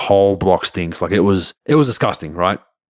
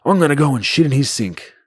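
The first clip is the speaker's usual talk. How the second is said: higher, put-on voice for the teammate's boast; mischievous teammate; 7 semitones higher than usual. Teammate boasting to the narrator about his sink prank, put-on voice. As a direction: play the teammate announcing his prank with glee. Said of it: "So my teammate goes".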